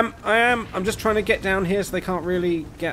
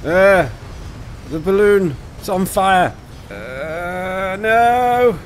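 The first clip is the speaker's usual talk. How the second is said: alarmed; 5 semitones higher, 5 dB louder, much slower than usual. Real, not acted. Balloon on fire in game.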